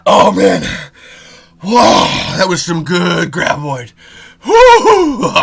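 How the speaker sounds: loud, high, whooping buzz; mock high rush. Host pretending he's high on graboid, whooping. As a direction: ham up a big hit.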